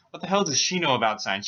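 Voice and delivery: ordinary delivery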